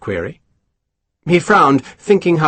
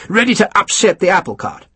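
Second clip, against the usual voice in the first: snarled; 8 semitones higher than usual. Acted. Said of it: "he snarled".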